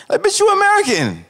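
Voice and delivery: very high, squealing exasperation; exasperated retort. Real, not acted. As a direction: throw it back in disbelief. Exasperated retort to imagined girl.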